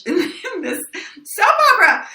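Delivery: high-pitched, giddy announcement; excited retelling. Retold or imitated. Agent telling the actress she got the lead, excitedly. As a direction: burst upward into a mock squeal of big news. Agent's big news, retold with glee.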